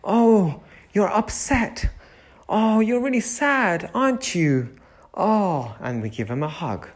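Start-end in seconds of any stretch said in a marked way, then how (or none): 0.0-5.8 put-on higher, tender voice for an upset toddler; soothing a child. Shown as a demonstration. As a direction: comfort little Tommy like a nursery teacher. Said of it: "angry, we say".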